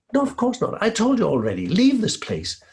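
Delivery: sharp, high and loud; angry refusal. Acted. Lady of the house angrily turning the girl away. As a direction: snap her away from the door.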